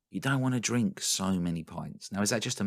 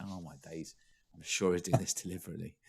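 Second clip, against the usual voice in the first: muttered aside; 8 dB quieter than usual. Retold or imitated. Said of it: "I'm like".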